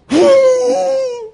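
shrieking burst of laughter; a high, loud howl of laughter; high whooping laugh. Real, not acted.

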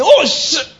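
high, loud startled exclamation; high yelp of surprise; mock shocked curse. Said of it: "If you just say everything".

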